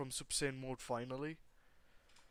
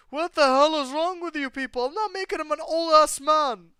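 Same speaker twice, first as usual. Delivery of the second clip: high, loud outraged scream at chat; furious shriek; 13 semitones higher, 14 dB louder than usual. Real, not acted. Streamer screams at chat for wanting an old Izuku, outraged. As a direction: shriek it at the whole chat in disbelief.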